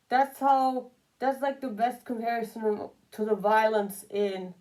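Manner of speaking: ordinary talk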